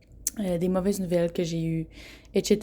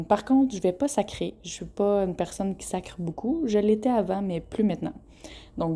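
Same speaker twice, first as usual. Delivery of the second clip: ordinary talk, nothing marked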